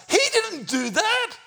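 very high-pitched emphatic denial; incredulous denial. Real, not acted. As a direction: squeal it in disbelief. Preacher rejects his mild retelling.

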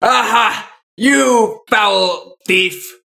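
drawn-out, theatrical cry of capture; triumphant accusation in character. Acted. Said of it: "still can't hear anything, so he's gonna say".

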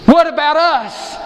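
high, loud, drawn-out plea; hammed-up plaintive question; pleading disciples. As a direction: cry out as worried followers wanting their share. Acts out the disciples' anxious question.

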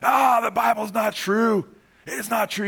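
loud jeering heckler, pitched high and slow; mocking heckler. Preacher voicing a heckler who shouts the Bible is false.

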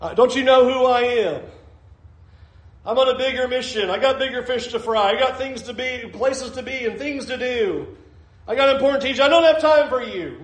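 mock arrogant Jesus waving off the blind men; arrogant big shot. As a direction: brush them off like a busy celebrity. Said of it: "notice that Jesus doesn't go".

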